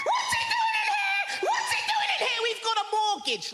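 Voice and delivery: high, loud panicked shriek at a mouse; high frantic yelp, slowed. Retold or imitated.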